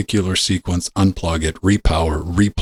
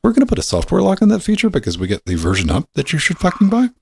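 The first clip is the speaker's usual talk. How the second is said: mock company voice; 12 semitones higher than usual. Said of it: "the company's like".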